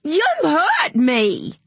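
put-on high, loud and slow child's scream; boy screaming at his mother after slipping. A narrator's reading. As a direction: wail it as if badly hurt. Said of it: "screamed Ziggy".

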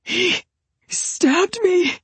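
very high, strained gasp; high-pitched gasping, words broken by a pause. A narrator's reading. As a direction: gasp it out as if wounded. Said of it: "clasped the side of his neck and gasped".

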